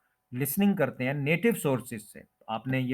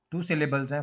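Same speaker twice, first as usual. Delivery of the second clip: ordinary talk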